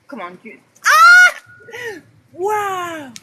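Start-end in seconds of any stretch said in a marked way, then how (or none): 0.9-1.4 high, loud sudden shriek; real startled shriek; startled scream. Real, not acted. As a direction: scream at the flash. Lightning strike, shriek.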